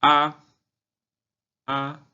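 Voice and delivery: "A" held high and level; first tone. Shown as a demonstration. Teacher practising tones on syllable A, high level "A". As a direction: sustain "A" on one high note. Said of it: "vamos a practicar los tonos".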